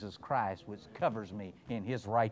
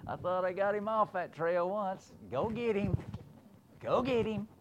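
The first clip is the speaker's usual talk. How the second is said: lightly voiced God, somewhat higher, giving orders; God sending help; 8 semitones higher than usual. God ordering the hound of heaven after him. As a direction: send the hound off urgently. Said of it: "God would have to say".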